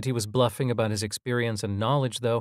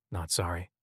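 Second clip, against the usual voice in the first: under the breath; 5 semitones lower, 8 dB quieter than usual. A narrator's reading. Omri's muttered retort to Dad.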